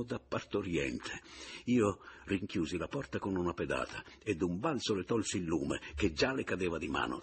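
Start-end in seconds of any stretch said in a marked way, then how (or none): none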